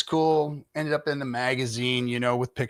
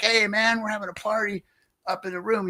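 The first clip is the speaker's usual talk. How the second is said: higher, eager party-dude voice; punk guy invite; 7 semitones higher than usual. Retold or imitated. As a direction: pitch it up like a pumped punk rocker calling you over. Punk guy inviting him upstairs.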